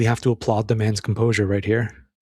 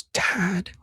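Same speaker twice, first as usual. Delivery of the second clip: pleading son; 8 semitones higher, much slower than usual. Retold or imitated. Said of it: "And Nick goes".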